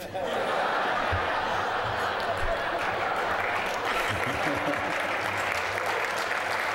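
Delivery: laughing at the joke; breathy delighted laughter; hearty laugh. Real, not acted. Laughs at uncle's envelope joke.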